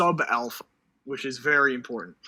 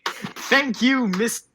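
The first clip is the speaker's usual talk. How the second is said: gushing; 8 semitones higher, slower than usual. Real, not acted. Gushing over chat compliment.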